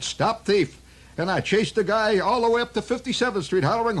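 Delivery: high, put-on Irish brogue of an old cop. Officer O'Leary tells the narrator about the young men, Irish brogue. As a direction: grumble like a beat cop left behind. O'Leary recalls chasing thief.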